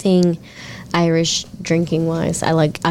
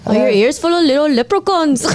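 put-on Irish accent; 6 semitones higher than usual. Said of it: "how to do an Irish accent".

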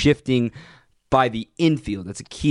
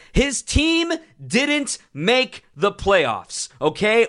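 high and slow, every word leaned on; exasperated, drawn out; emphatic point; 9 semitones higher, much slower than usual. Real, not acted. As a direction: drive the point home, slowly. Hammering point in rant.

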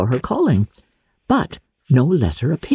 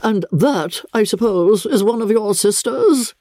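higher, stiff, looking down on them; haughty lady; 9 semitones higher than usual. A narrator's reading. Visiting lady coolly questions the daughter, stiffly. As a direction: ask as if the answer bores you.

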